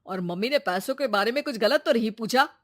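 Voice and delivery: loud, stern reprimand; stern father raising his voice; scolding father. Acted. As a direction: tell the son off forcefully.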